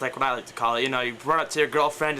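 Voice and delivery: ordinary talk, nothing marked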